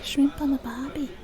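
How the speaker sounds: tentative stock Australian accent; warm-up phrase in put-on Aussie. Shown as a demonstration. Finding her way into the accent, attempted Australian. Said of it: "how do I do an Australian accent".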